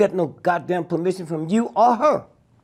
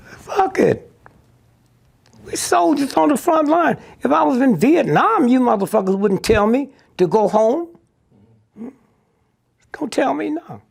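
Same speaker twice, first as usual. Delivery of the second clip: heated; 6 semitones higher than usual. Real, not acted. Defiant outburst about soldiers.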